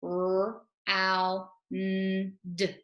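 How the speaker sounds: each sound said separately: L, ow, r. Shown as a demonstration. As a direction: sound out L, ow, r one by one, like a teacher. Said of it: "Let's go through and make the sounds".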